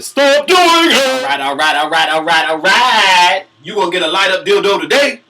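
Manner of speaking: Oprah voice slipping back in; hammed-up Oprah still going; can't stop the Oprah impression. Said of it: "my Oprah voice".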